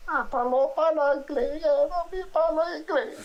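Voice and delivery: high, loud put-on Italian accent; mock Italian. Old woman answering in fake Italian. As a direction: ham up Italian while meaning French. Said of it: "That's Italian".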